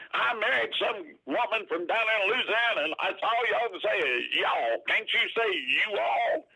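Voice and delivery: high-pitched mock New Yorker complaint; New York accent. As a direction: imitate an exasperated New York Yankee up high. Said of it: "he had that New York accent".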